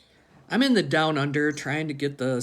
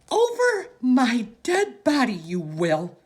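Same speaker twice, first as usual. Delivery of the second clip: much higher, louder, slow screaming through tears; furious tearful shout, much higher and louder; tearful yell; 11 semitones higher, 9 dB louder, slower than usual. Acted. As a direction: scream at your husband, near tears. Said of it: "That's Graham yelling".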